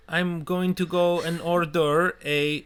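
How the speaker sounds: slow, hammed-up Italian accent; mock Italian accent. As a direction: put on a thick fake Italian voice. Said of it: "Italians will be like".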